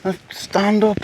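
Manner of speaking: high, slow, wide-eyed dolphin voice; be a dolphin amazed by people on land; excited dolphin. Pretend. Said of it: "And they're like".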